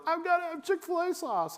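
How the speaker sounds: very high, squeaky imitation of a kid; mock a child pleading in falsetto; put-on little-kid whine; whiny kid. Mimicking a demanding kid.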